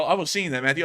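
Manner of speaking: normal talking voice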